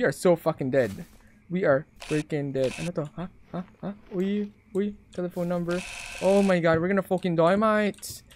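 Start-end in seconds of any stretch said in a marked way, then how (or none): none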